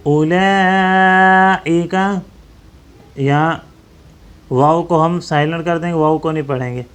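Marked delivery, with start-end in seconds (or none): 0.1-1.9 اولئک with the madd held a five count, very slow; long madd. Shown as a demonstration. Teacher showing students the five-count madd in اولئک. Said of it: "اس کو ہم پانچ انگلی کی مقدار کھینچیں گے".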